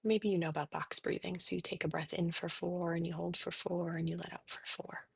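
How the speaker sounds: soft, unhurried meditation voice with long pauses; guided meditation. Real, not acted. Therapist leading listeners through breathing and body noticing, soft meditation. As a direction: guide them gently into settling, softly.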